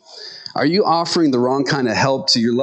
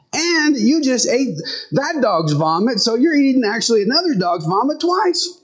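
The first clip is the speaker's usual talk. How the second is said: higher, coaxing talk to a dog; reasoning with dog; 8 semitones higher than usual. Acted. Trying to reason with the vomiting dog, higher coaxing voice. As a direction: reason with the dog like a patient owner, pitched up. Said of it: "reason with the dog and say".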